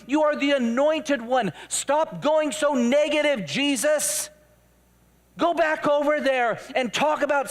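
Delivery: high, insistent pleading rant; hammed-up exasperation, phrase piled on phrase; indignant rebuke. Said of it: "began to rebuke him".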